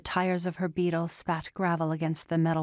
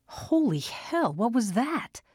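higher, jolted alarm; startled; 6 semitones higher, slower than usual. A narrator's reading. Father hearing a scream next door, startled. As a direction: caught off guard by a scream.

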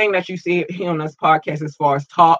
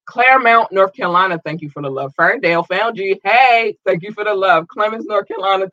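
ordinary delivery

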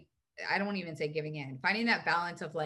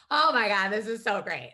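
excited outburst; 3 semitones higher, 8 dB louder than usual. Retold or imitated. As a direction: exclaim it like a sudden breakthrough. Acting out joy at a breakthrough.

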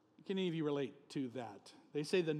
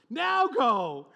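commanding shout; 8 semitones higher, 14 dB louder than usual. Acted. God commanding Moses.

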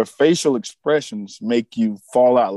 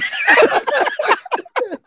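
a high, helpless burst of laughter. Real, not acted. Cracking up over the dinner scene: high, helpless laughter. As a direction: crack up at the memory, high-pitched.

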